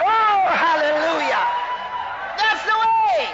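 loud, high praise shout; exultant preaching shout. Real, not acted.

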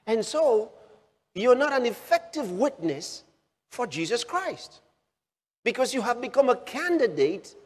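pulpit fire, pressing the point; preaching at full force. Acted. Preacher rousing the congregation about worry, full preaching force.

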